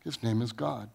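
quiet, reverent restatement of "His name is God"; hushed repeat. Acted. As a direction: let it fall to a reverent hush.

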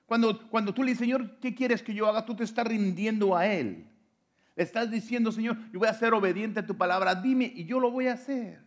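high, insistent preacher's call; fervent preaching. Acted. Pastor urging the church to surrender, fervent preaching. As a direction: urge the congregation to surrender.

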